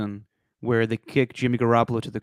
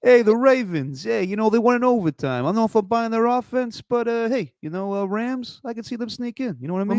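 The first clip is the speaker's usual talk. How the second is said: high-pitched, hammed-up Italian-American accent; 10 semitones higher than usual. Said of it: "do the rest of these in Italian accent".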